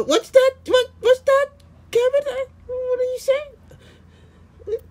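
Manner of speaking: high silly voice asking what's going on; squeaky character voice. Acted. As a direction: pitch way up as a confused little character. Second character voiced in skit.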